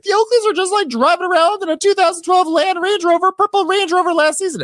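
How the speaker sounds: shrill mock dig; mock Kelly. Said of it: "Kelly's like".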